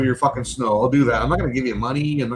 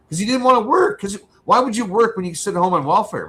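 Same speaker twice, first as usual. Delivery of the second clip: higher and louder, fed up; heated rant; 9 semitones higher, 5 dB louder than usual. Real, not acted. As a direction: let the frustration boil over. Venting about lazy friend.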